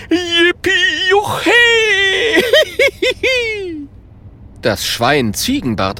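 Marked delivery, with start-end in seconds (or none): 0.1-1.5 much higher, loud jubilant shout; joyful cheer. A narrator's reading. Holunder transformed back cheers, jubilantly. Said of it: "reißt die Arme in die Luft und ruft". 1.5-3.8 high, loud joyful laughter; happy laugh. A narrator's reading. Holunder laughs with joy after cheering.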